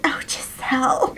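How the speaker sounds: high, whispery swoon; hammed-up romantic whisper; mock swooning. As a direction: breathe the name like a smitten lover. Queen voiced swooning over Giselle.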